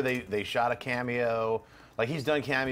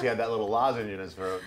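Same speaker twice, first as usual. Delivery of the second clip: ordinary talk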